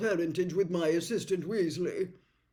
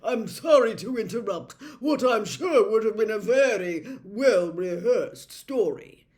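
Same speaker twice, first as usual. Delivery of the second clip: supercilious; 5 semitones higher, 12 dB louder than usual. A narrator's reading. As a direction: talk down to the whole room. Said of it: "very supercilious look on his face".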